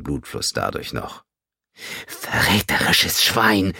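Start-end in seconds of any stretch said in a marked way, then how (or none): 2.1-3.7 slow, hissed female rage; raging. Acted. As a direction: spit the insult out slowly. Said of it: "tobte sie". (langsam und wütend geschimpft)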